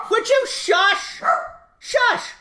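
very high, slow, exasperated hushing; high pitched hushing at the dogs. Real, not acted. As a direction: shush the dogs in real frustration.

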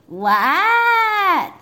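loud, high shouted reply; yelled. Acted. Froggy answering his mother from the snow, a loud yell. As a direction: shout back from outside in the snow. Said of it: "yelled Froggy".

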